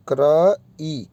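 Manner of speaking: Cry drawn out slowly as one clear syllable; slow model pronunciation. Shown as a demonstration. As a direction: say cry slowly so the vowel sound is heard. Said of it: "sound क्या आ रही है".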